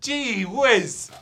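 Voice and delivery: high, loud goofy exclamation; hammed-up gee-whiz voice; mock goofy voice. Mock voice after impression banter.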